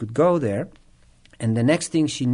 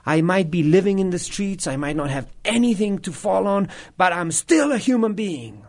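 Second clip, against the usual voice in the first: higher, insistent acted plea; mildly indignant retelling; 6 semitones higher than usual. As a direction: imitate someone insisting on their dignity. Acts the attacker's indignant reply.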